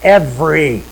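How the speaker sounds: "Every" said alone, firm and falling; one weighty "Every" after a pause; stress on every. Shown as a demonstration. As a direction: set "Every" apart as a single word. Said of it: "emphasize another word in our hearts".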